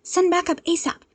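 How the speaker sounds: urgent hero call. Acted. Ochako radioing the agency for backup, urgent.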